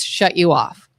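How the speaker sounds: usual speaking voice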